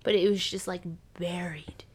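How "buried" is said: dramatic hush; mock-mysterious hush. Dramatic hushed emphasis on buried.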